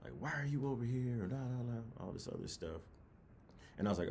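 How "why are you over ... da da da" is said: higher, louder imitation of a shout; mock instructor yelling. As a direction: yell it into a recruit's face. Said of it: "they're yelling in my face".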